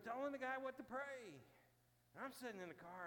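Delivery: ordinary talk, nothing marked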